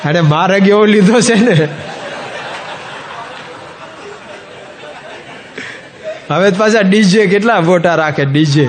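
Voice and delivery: can't keep a straight face; laughing while telling. Real, not acted. Laughing as he tells the congregation a wedding story.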